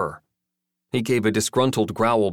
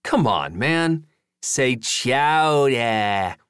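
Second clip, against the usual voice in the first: put-on coaxing, drawn-out New England accent; 4 semitones higher, much slower than usual. A narrator's reading. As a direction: plead in a hammed-up New England accent, stretching the last word. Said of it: "practicing his New England accent".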